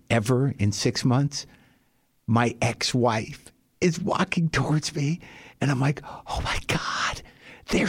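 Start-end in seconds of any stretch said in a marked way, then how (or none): 6.0-7.9 slow, breathless whisper of shock; hushed whisper. Retold or imitated. Spotting his ex-wife approaching, shocked whisper. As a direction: whisper it like she might hear you.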